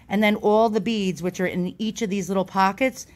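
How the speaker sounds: normal talking voice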